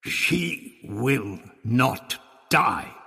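very slow, each word set apart; adamant. A narrator's reading. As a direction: lay it down word by word, no argument allowed. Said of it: "Her voice was adamant".

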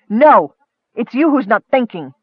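forceful interrupting denial; impatient, angry comeback; heated retort. Acted. Cuts him off in argument.

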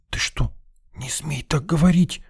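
hushed, high, scared protest; fear in voice. A narrator's reading. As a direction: forbid it fearfully, keeping the voice down. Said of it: "В её голосе прорезался страх".

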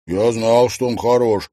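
loud, proud and pleased. Acted. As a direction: say it delighted with himself. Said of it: "радостно кивнул Трофимов".

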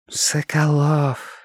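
slow, languid sultry "Соколов". A narrator's reading. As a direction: draw out the name seductively. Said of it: "томно сказала она".

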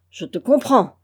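loud, fierce Je te comprends; violent outcry. A narrator's reading. The master at his servant, violent cry. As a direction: shout it violently. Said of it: "s'écria violemment Vaunois".

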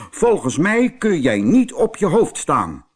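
high, loud challenge from the bull; challenging bull. Acted. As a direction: taunt the elephant proudly.

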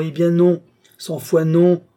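loud, high, emphatic outburst; furious refusal. A narrator's reading. Old man refuses his heir the science, furious refusal. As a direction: refuse with full force.

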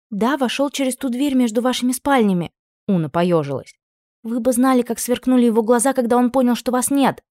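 put-on high, breathless girlish telling; excited young maid. A narrator's reading. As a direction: gush it out wide-eyed. Maid tells of duke.

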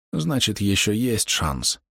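slow, lightly voiced wounded man; wounded archer. A narrator's reading. Dying archer finds a last chance, slow weakened voice. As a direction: say it slowly, as a spent fighter.

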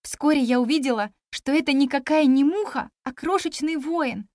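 ordinary delivery, nothing marked

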